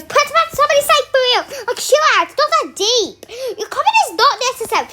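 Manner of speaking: high, loud, fast, laughing rant; exasperated outburst. Real, not acted. Reading absurd comments, a high, loud, laughing rant. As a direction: blow up at the comment section in disbelief.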